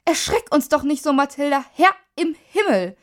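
high, loud, indignant fright; startled scolding. A narrator's reading. Startled family to the neighbour at the window, scolding. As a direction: scold after a sudden scare.

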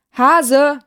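calls the name out loud and high; bellowing. Acted. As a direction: yell for the rabbit across the sleigh. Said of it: "brüllte er".

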